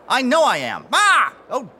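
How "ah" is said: high yelp of pain; sharp yelp. Acted. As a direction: cry out at a sudden sting. Now I've got a paper cut.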